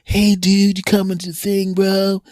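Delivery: high-pitched surfer dude voice; Woody. Retold or imitated. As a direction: imitate a mellow surfer, stretching every "bro". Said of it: "'cause he like".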